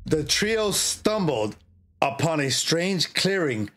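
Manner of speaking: slow, dramatic story reading; story narration. A narrator's reading. Streamer resuming the horror story for viewers, spooky narration.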